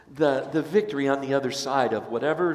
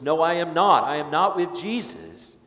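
emphatic denial; 5 semitones higher, 7 dB louder than usual. Acted. Peter denying Jesus at fire.

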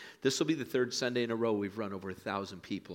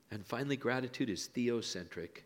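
usual speaking voice

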